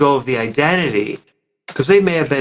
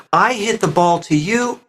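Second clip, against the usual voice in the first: normal talking voice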